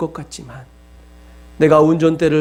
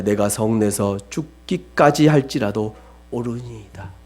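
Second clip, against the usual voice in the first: lower, slow sullen reply; Jonah answering; 8 semitones lower than usual. Retold or imitated. Jonah answering God, low and slow stubborn reply. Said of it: "그가 대답하되".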